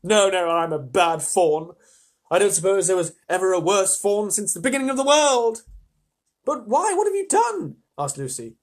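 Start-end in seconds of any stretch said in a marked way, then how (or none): 0.1-5.6 despairing fawn. A narrator's reading. 6.4-7.8 anxious question. A narrator's reading.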